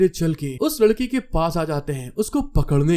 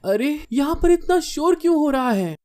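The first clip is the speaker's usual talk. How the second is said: high-pitched puzzled question; puzzled girl; 8 semitones higher than usual. A narrator's reading. Girl woken by the noise, puzzled. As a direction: voice a girl high, asking what's happening.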